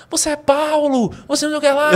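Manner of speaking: high, loud mock shouts from the audience; imitated crowd shouting. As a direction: cry out like a fervent crowd member. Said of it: "O pessoal começou a gritar".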